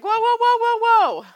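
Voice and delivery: high, loud string of whoas; mock panicked protest. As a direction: imitate an overwhelmed kid halting someone, high-pitched. Son overwhelmed by the numbers.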